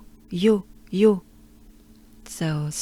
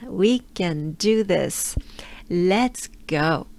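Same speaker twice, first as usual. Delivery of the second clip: normal talking voice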